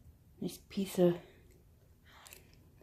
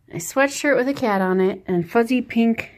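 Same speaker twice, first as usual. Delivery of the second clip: normal talking voice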